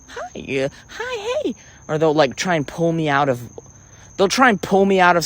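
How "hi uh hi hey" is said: very high, soft little greeting; tiny high-pitched mock hello; imitated woman. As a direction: chirp a quiet, mocking hi. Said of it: "they'll f-, say something like".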